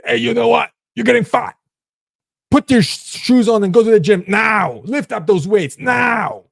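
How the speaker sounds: high, loud shouted orders; harsh drill voice. Pretend. Tough inner voice ordering you to the gym, shouting. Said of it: "That's the voice that tells you".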